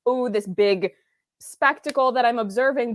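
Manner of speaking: ordinary talk, nothing marked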